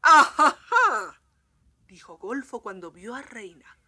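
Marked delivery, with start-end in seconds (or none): 0.0-1.2 gloating aha. Acted. Golfo's gloating exclamation.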